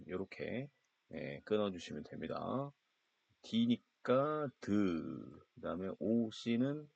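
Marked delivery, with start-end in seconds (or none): 3.4-4.7 드를 아주 길게 늘여서; 소리 하나씩 천천히 끊어; slow sounding out. Shown as a demonstration. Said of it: "끊으면 발음 나는 대로". (천천히 끊어 읽기)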